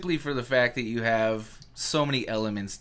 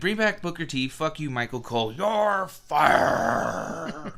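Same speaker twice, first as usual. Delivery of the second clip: hammed-up higher pitched tirade; mock firing again; 5 semitones higher, 4 dB louder, slower than usual. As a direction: shout it like a cartoon boss. Continuing mock firing rant.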